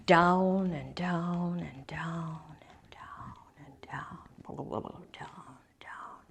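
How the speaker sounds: slow, sinking into a whisper; fading descent. A narrator's reading. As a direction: let each word drop away softly into the depths. Narrating the deep dive.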